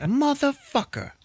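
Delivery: high, drawn-out comic curse; mock outrage. Marcus told it's his height, outraged. Acted rejected date curses.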